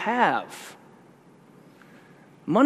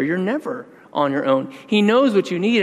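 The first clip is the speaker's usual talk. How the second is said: usual speaking voice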